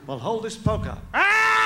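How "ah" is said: high, loud comic yell of pain; sudden high-pitched scream, hammed up. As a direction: yelp as if handed a hot poker. Reacts to holding the poker.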